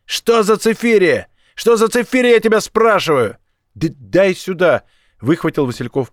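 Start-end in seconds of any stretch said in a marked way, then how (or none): 0.0-3.4 high, loud, angry interrogation shout; yelling in his ear. A narrator's reading. Young thug questions the deafened captive about the figures, yelling. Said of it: "юнец заорал прямо в ухо". 3.7-4.8 slow, stuck first sound, then demand; stammered demand. A narrator's reading. Shell-shocked captive snatches the folder from the thug, stammering.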